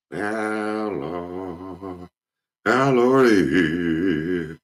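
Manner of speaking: drawn-out preacher cry, high and loud on Lord; praying deacon. Retold or imitated. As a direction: wail it like an old deacon at the altar. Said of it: "the way he sounded".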